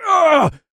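sudden loud, very high groan; pained cry. Acted. Potion drinker seized by violent quivering, pained cry. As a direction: cry out as a jolt hits. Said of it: "my entire body violently quivered".

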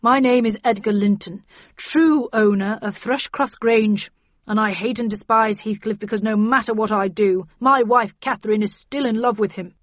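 loud, aggrieved self-introduction; Edgar Linton, resentful. A narrator's reading. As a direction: declare a grievance to the group. Voices Edgar introducing himself resentfully.

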